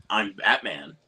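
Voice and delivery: low, uncreepy superhero line; understated Batman, no creepy voice; George Clooney's Batman. Retold or imitated. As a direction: imitate a plain-spoken Batman, slightly low. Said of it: "he doesn't even say it like the creepy voice".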